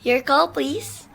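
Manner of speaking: slow, distant operator voice down a phone line; operator over the phone. Acted. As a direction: answer the call like a switchboard operator.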